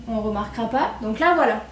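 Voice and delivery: normal talking voice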